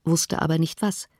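ordinary delivery

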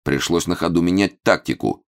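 usual speaking voice

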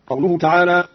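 ordinary delivery

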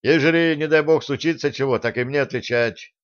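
ordinary delivery

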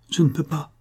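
a touch of anguish and rage; pained outburst. A narrator's reading. Servant girl refusing to confess to her mistress, pained outburst. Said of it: "avec une explosion de douleur et de rage".

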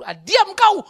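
high loud angry retort; father shuts up teacher. Retold or imitated. Father silencing the teacher, loud angry. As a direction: snap at the teacher. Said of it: "kata guru".

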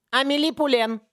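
put-on a young assistant's voice breaking as he calls out; ломающимся голосом. A narrator's reading. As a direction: voice cracking high on the name. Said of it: "произнёс ломающимся голосом". (голос срывается на высокой ноте)

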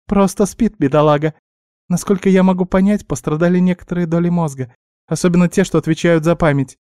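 high little-animal voice, matter-of-fact; explaining guinea pig. A narrator's reading. Guinea pig explaining the dragon's memory loss, high and earnest. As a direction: lay out the diagnosis in a high squeak.

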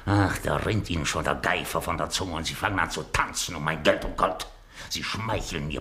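ordinary talk

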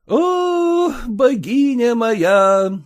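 high, slow adoring cry; rapturous exclaim. A narrator's reading. Kutsin exclaiming to Agniya, high and adoring. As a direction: swoon over her. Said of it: "воскликнул он".

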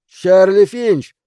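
slow, loud, high name call. Acted. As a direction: call the name across the water. Man calls out name in storm. (громкий окрик)

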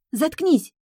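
high-pitched irritated shriek; sharp, very high girlish outburst; angry squeal. A narrator's reading. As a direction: squeal it at the one mocking you. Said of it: "взвизгнула девчонка".

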